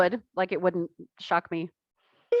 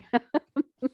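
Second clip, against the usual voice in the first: bright burst of real laughter; high laugh; 9 semitones higher than usual. Real, not acted. Laughing at herself.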